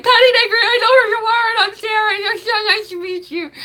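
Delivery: high, loud squealing fan greeting; gushing fangirl. Pretend. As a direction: squeal it at her like a starstruck fan. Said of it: "go up to her and be like".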